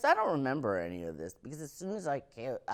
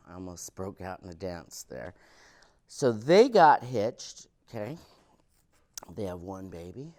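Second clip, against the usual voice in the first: ordinary talk, nothing marked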